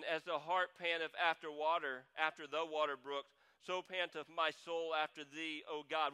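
reads the verse out to the congregation; steady, solemn Bible reading; scripture reading. Acted. Pastor reading Psalm 42 aloud to the church, solemn reading.

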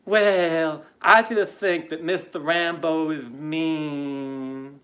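slow, whiny lisp with drawn-out last syllables; mocking effeminate lisp. Host imitates Wilbur complaining about a man, mocking effeminate lisp. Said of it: "this Wilbur talks glitter face".

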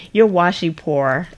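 loud, laughing mock taunt; playful teasing. Teasing a viewer who has few washi rolls, playful taunt. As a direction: rib them loudly between laughs.

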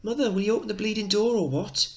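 high, loud little lad shouting through the door; boy yelling at his mother through the door; cheeky young boy. Acted. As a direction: play a seven-year-old kid, impatient. Teacher voices child Mickey.

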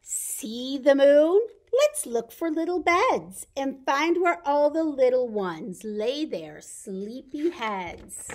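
lively storybook read-aloud, slowing at the ends; bright read-aloud for toddlers; reading to children. Acted. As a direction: read the picture book to the little ones. Reads picture book to children.

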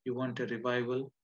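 usual speaking voice

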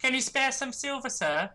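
high-pitched pleading beggar; playful beggar. Acted. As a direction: beg like a cheeky street urchin. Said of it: "choosiest beggar I've ever seen".

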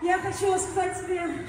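ordinary delivery

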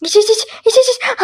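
frantic pleading. Acted. Gasping to drink.

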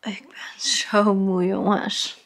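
exhausted whisper; tired whisper. Real, not acted. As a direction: whisper it, worn out. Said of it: "zien jullie mijn gezicht".

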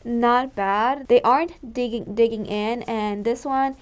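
muffled behind cup respirator; speaking into mask; through a face mask. Real, not acted. Seen 2: Cup mask over mouth and nose throughout; removed by 10.6.

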